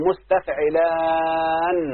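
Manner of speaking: مستفعلان with a long, held aan at the end; lengthened ending. Shown as a demonstration. Teacher showing students the added letter, lengthened ending. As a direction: stretch the last alif of مستفعلان slowly into the nun. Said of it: "فنقلب النون ألف ونجيب نون".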